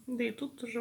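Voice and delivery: normal talking voice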